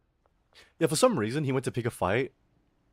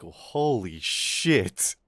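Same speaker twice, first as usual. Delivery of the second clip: very slow, drawn-out amazement; stunned; 2 semitones lower, much slower than usual. Retold or imitated. Onlooker reacting to a classmate getting punched, slow stunned awe. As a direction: stretch both words out in retold shock.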